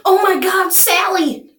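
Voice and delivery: high-pitched, hammed-up outcry; mock alarm. Mock public panic over abducted Sally.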